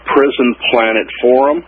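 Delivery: usual speaking voice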